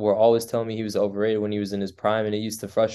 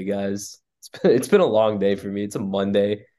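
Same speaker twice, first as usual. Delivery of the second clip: ordinary talk, nothing marked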